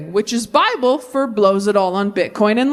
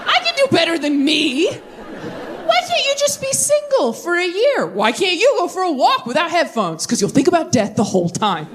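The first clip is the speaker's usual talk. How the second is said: mocking friends' advice; 7 semitones higher than usual.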